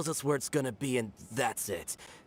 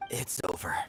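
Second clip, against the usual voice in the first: whispered resignation; 7 semitones lower than usual. Acted. Thug giving up, muttering.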